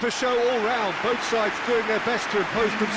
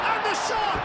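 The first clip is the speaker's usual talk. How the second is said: high, sudden jump on the shot; rising shot call; 13 semitones higher than usual. Real, not acted. Commentator calling a shot on goal, high and excited. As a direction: leap up as the striker shoots.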